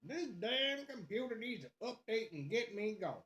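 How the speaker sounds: hammed-up southern grumble; southern accent. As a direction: gripe in a put-on southern accent. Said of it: "break out my southern accent".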